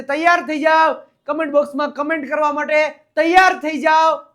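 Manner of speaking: loud, fast rallying call to viewers; rallying shout. Shown as a demonstration. Teacher hyping viewers to comment, loud rallying shout. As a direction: pump up the audience, shouting.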